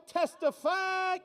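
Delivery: preacher call. Acted. Comic calling on crowd like a preacher, high and slow. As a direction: preach it to the church. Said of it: "Anybody wanna testify?".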